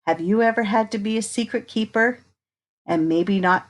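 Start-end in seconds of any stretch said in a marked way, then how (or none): none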